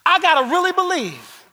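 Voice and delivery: loud, high preaching voice; fiery sermon delivery; full-force preaching. Acted. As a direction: preach it out hard.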